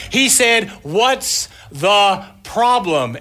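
loud, slow holler, high at the start; mock shouting over a storm; relayed shout. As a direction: pass the message on at a yell, stretching the words. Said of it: "And he says".

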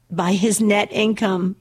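punchline with a real laugh breaking in; chuckling as she lands it; laughing through punchline. Real, not acted. Host laughing at her own joke for listeners. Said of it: "This is hilarious".

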